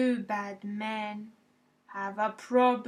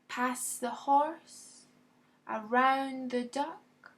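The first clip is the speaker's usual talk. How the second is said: ordinary delivery, nothing marked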